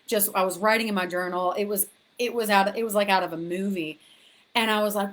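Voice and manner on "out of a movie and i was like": normal talking voice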